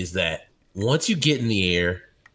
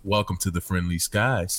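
soothing announcement; 6 semitones lower, 4 dB quieter than usual. Retold or imitated. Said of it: "How do we go from".

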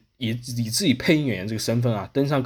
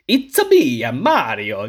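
Mario impression; 4 dB louder, much slower than usual. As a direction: ham up the Italian accent. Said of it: "有一个什么音，就会学一下". (夸张的意大利口音模仿马里奥)

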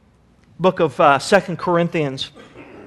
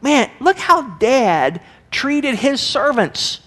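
son's realization; 8 semitones higher than usual. Retold or imitated. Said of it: "It's all like".

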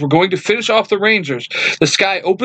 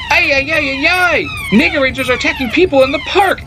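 much higher, fast, frantic robot voice; panicked robot Alpha; 13 semitones higher than usual. Acted. Alpha warns the Rangers of the attack, frantic high robot squeal. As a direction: squeal it out like a flustered little robot.

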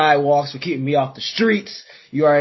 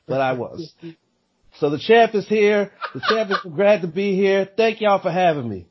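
usual speaking voice

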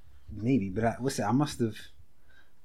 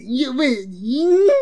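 mock whiny voice; 13 semitones higher, 8 dB louder, much slower than usual.

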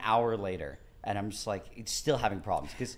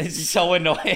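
normal talking voice

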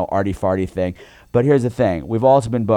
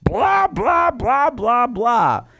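high sing-song mock babble; falling blah blah drunken taunt; 12 semitones higher, much slower than usual. Drunk rant mocking Bradford.

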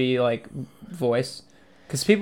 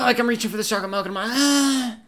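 normal talking voice

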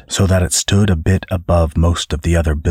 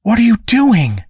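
high, appalled outburst; shocked cry; 4 dB louder than usual. A narrator's reading. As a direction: demand it in high-pitched disbelief. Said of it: "Cassandra cried".

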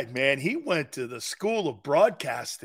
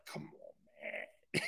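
whispered, fading scoff; whispered come on man; 17 dB quieter, much slower than usual. Real, not acted. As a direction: scoff it barely aloud. Host scoffs at quarterback quote.

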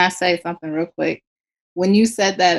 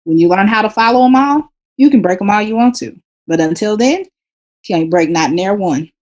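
louder and higher, laying down the law; stern parent lecture; 3 semitones higher, 7 dB louder than usual. Retold or imitated. Mother lecturing her son about grammar rules, stern and loud. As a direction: scold like a strict parent drilling a rule. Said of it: "And this is what I tell him".

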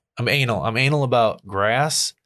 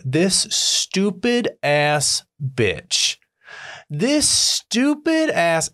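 slow, scornful, pitched up on the second try; drawn-out annoyed cursing; 8 semitones higher, much slower than usual. Retold or imitated. Watching the neighbor shovel, slowly cursing her in disgust. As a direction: drag out the insult in disgust. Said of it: "I'm at the window and I'm going".

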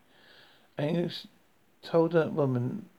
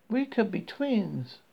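higher, slow Southern drawl; lightly voiced Southern woman; friendly Southern accent; 7 semitones higher, slower than usual. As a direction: greet a stranger like a chatty Southern gal. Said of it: "speaking in a friendly southern accent".

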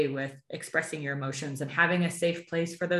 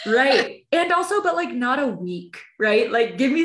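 excited; 6 semitones higher, 6 dB louder than usual. Real, not acted. Excited reaction to pod dating show.

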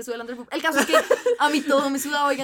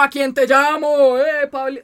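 louder, slow mock of the unbothered father; imitating calm dad; 2 semitones higher, 10 dB louder, slower than usual. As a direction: drawl it like a dad who can't be rattled. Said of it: "El man fue como". (papá imitado, lento y tranquilo)

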